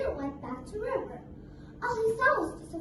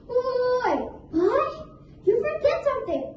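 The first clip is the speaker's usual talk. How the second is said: loud, higher protest; indignant; 5 semitones higher, 6 dB louder than usual. Acted. Crocodile protesting to Mouse Deer, loud. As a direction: stop him short.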